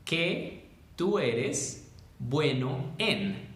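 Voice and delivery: slow, separated words; word-by-word translation. Shown as a demonstration. Teacher translating literally for students, slow word by word. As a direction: spell out the literal translation slowly. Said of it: "La traducción".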